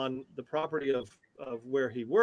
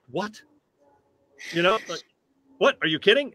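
mock stunned what, pitched up and louder; retold astonished outburst, raised and louder; stunned disbelief; 5 semitones higher, 7 dB louder than usual. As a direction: replay the moment the news landed. Hearing surprise invitation news.

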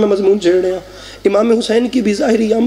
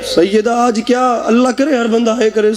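ordinary talk, nothing marked

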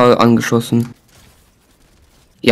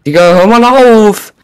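loud, high fed-up yell; annoyed shout; 7 semitones higher, 5 dB louder than usual. Real, not acted. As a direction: yell at him to quit it. Friend scares off the llama.

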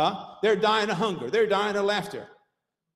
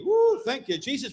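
joyful whoop; 6 semitones higher than usual. Real, not acted. Joyful shout of praise.

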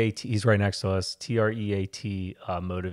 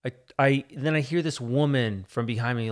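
ordinary delivery

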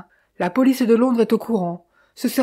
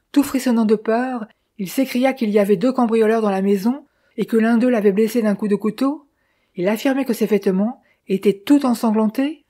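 plain reading voice

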